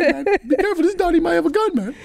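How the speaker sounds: high-pitched mock egging-on; goading street boys. Boys goading their friend about the American, high and teasing. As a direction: wind the friend up, squeaky with glee. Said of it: "their friends was like".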